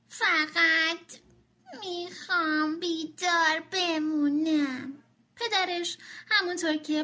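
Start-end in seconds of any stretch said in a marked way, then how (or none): 0.1-5.0 slow, broken-up words with pauses; haltingly. Acted. Tommy answering his mother haltingly. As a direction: get the words out in broken bits. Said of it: "بریده بریده گفت".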